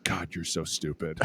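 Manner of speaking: very soft, muttered contempt; disgusted senior. Retold or imitated. Senior looks over at the freshman, muttered mock disgust. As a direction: mutter it aside, imitating total disgust. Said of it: "looking over at me like".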